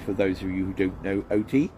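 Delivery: ordinary delivery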